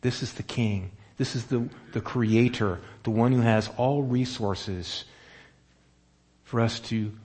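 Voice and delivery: ordinary delivery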